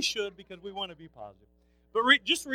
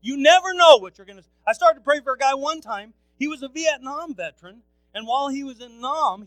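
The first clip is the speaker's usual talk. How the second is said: preaching at full force; 8 semitones higher, 22 dB louder than usual. Acted. Pastor urging his congregation, full-force preaching. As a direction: call the church to bless people. Preacher launches next story.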